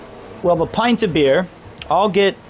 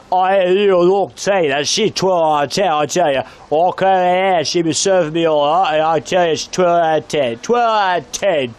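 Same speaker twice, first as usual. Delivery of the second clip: put-on drunk local, words run into mush; slurred drunk impersonation. Drunk local praises waitress, slurred mock ramble. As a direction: garble it like a plastered pub regular. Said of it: "I'm gonna recreate exactly".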